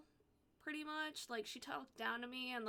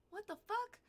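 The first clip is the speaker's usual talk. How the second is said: sharp high what-the-fuck; stunned outrage, pitched way up; shocked disbelief; 10 semitones higher than usual. Retold or imitated. Hearing the nurse say he's going home, shocked disbelief. Told dad sent home suddenly.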